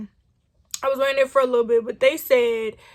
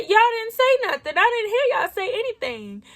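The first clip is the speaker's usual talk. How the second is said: indignant protest; 9 semitones higher than usual. Retold or imitated. Protesting to train staff.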